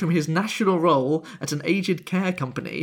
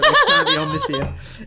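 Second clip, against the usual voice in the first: laughing; 6 dB louder than usual. Real, not acted. Laughing aside about the BBC.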